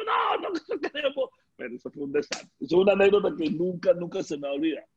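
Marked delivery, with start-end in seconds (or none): 0.0-1.3 fans refusing. Retold or imitated. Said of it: "y el tipo".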